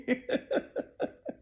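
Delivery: short airy laugh of disbelief; breathy laugh. Real, not acted. Laughing at her own doubts about her mom, airy chuckle. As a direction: let a soft breathless laugh escape. Laugh after incredulous question.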